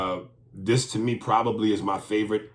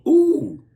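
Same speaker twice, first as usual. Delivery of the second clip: high loud surprised ooh; squealed mock ooh; 9 dB louder than usual. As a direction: yelp it out surprised. Imitated surprised reaction.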